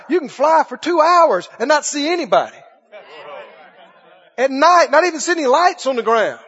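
loud, fast revival preaching; fiery preaching. Acted. Preacher shouting to his congregation about empty land. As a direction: preach it to the rafters.

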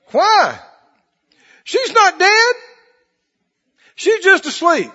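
high, loud preaching; preaching at full force. Acted. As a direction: drive the story home at full voice. Jesus quoted in full preaching.

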